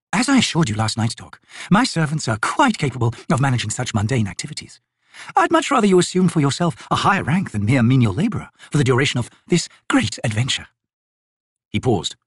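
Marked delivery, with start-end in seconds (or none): 0.1-10.7 lilting, higher-pitched noblewoman; airy, lordly assurance to an underling; haughty lady. Acted. Lady telling Tok to let servants do the labour, haughty.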